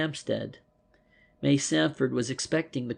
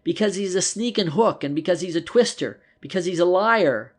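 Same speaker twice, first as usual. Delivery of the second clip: louder and higher, building scorn; rising indignant outburst, louder; indignant accusation; 5 semitones higher, 7 dB louder than usual. A narrator's reading. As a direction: spit out each insult, rising. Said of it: "said Willie promptly".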